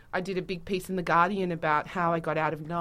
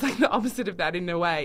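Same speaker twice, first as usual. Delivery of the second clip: ordinary talk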